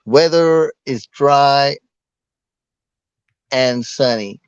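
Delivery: very slow, careful stab at an American accent; attempted American accent. Shown as a demonstration. Reluctant Brit asked to describe the weather, halting American accent. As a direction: try an American accent, word by word. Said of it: "Describe it with an American accent".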